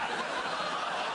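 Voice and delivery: laughing. Real, not acted. Laughing at own joke.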